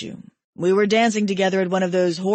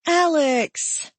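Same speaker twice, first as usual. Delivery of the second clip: high, bright cry of a name; excited young woman; 8 semitones higher than usual. Acted. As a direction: burst in calling his name with relief. Genesis bursts in relieved.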